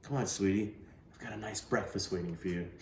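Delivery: hushed and gentle, well below the narration; real soft. Acted. Woman gently waking Bud for breakfast, hushed and soft. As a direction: coax a sleeping boy awake softly. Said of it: "She said, real soft".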